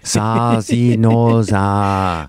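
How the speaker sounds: Ewok gibberish, lightly voiced; backwards speech. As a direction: babble like a little Ewok. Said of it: "talking backwards".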